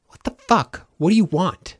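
high-pitched exasperated demand; exasperated outburst. A narrator's reading. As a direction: demand an answer, pitch jumping up. Denton snaps at unwanted company.

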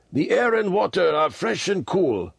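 put-on high-pitched, homesick old lama; long for the hills in a high old voice; wistful sighing lama. A narrator's reading. Old lama remembering his hills, high and wistful. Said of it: "he sighed".

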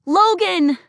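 high, loud desperate scream; breathless girl screaming for Logan under his window; screaming his name. Acted. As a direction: call out as loud as you can. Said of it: "she screamed as loud as she could".

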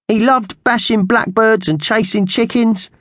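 very high magpie voice, breaking with grief. A narrator's reading. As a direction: squawk it as if about to cry. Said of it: "Slasher's voice was breaking".

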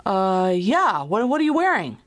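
high, stammering, thrown caller voice; confused man on the phone playing along; baffled caller. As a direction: stumble into the question, pitch climbing.

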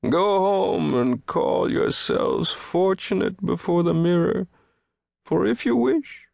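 high, mournful, self-pitying oration; wounded lament. A narrator's reading. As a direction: lament your misfortune to luckier listeners. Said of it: "wounded deeply by this new sorrow".

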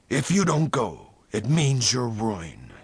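sharp warning, slowing at the end; a mildly sharp, deliberate threat. A narrator's reading. Toll warns Venters of ruin, sharp. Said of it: "he said sharply".

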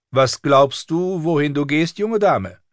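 high, drawn-out scolding governess voice; strict septa pulling a child up short; stern septa. A narrator's reading. Septa reprimands girl at table.

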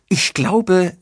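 high, loud excited exclamation. A narrator's reading. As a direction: burst out excitedly, pitched high. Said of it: "rief Denise aufgeregt". (aufgeregt gerufen)

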